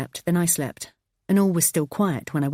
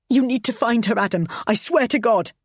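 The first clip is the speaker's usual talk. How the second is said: louder desperate demand; 6 semitones higher, 4 dB louder than usual. Acted. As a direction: plead it with menace. Wife pleading and threatening.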